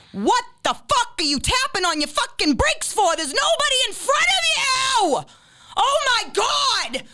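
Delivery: high-pitched exasperated yelling; road rage. Acted. Acts out rant at brake-happy drivers.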